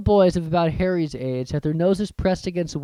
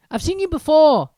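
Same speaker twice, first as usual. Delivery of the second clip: higher, lightly voiced young boy; a shy boy remembering; 11 semitones higher, 7 dB louder than usual. Narrator voices Harry.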